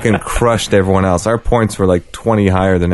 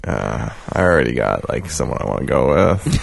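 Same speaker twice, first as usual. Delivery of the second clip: lower, softer, slower awkward excuse; mumbled excuse; 7 semitones lower, 6 dB quieter than usual. Retold or imitated. Turning down friends who messaged, low and awkward. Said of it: "I was like".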